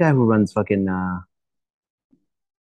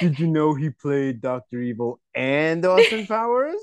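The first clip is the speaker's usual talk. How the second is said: slow teasing impression of an eager kid; lightly voiced know-it-all kid, slow; mock nerdy kid; 4 semitones higher, slower than usual. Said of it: "were you like".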